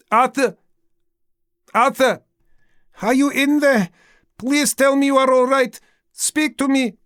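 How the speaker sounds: high, loud pleading calls; wife begging her husband to answer; calling out desperately. Acted. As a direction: shout his name through the door. Wife calling through the door.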